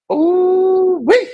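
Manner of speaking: high loud ooh-wee; excited squeal. Real, not acted. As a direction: whoop it up high. Excited exclamation.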